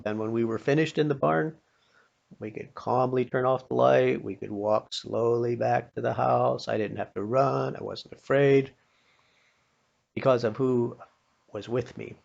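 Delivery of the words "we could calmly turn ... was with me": ordinary talk, nothing marked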